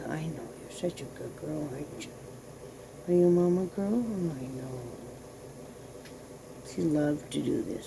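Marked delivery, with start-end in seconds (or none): 0.1-2.1 hushed whispered praise. Real, not acted. Owner whispering praise to her sleepy poodle. 3.1-4.8 cooing. Real, not acted. Cooing at the dog.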